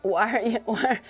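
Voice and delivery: usual speaking voice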